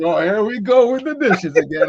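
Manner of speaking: exasperated mock complaint. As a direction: play the fed-up partner who saw it coming. Same complaint voiced again, higher.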